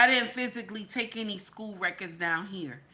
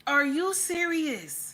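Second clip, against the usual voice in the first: much higher, slow, drawn-out disbelief; stunned disbelief; 8 semitones higher, 3 dB louder, slower than usual. Real, not acted. Streamer reacting to the children's story in slow, high disbelief. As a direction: stretch it out, not believing your ears.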